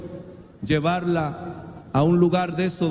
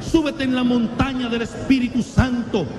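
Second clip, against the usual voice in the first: high, raised exhortation to the congregation; fiery preaching; 6 semitones higher than usual. Acted. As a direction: preach it out, calling them to climb aboard.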